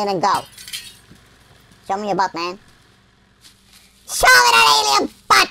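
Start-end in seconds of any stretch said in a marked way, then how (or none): 1.9-2.6 low and soft, offhand; low, casual demand. Real, not acted. Player casually asking the alien, low soft request. 4.1-5.5 high, goofy holler; high shouted demand. Pretend. Player demanding of the alien, high goofy shout.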